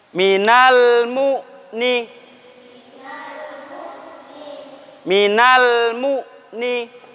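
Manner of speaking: drawn-out, very slow chanted recitation; slow tajwid recitation. Shown as a demonstration. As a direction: stretch each syllable like Quran reading for pupils to copy. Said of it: "Sekarang ikutin Ustadz cara bacanya".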